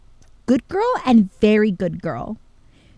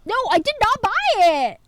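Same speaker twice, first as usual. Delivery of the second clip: emphatic denial; 11 semitones higher, 5 dB louder than usual. Real, not acted. Insisting to chat.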